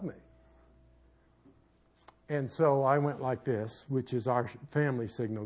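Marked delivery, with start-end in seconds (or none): none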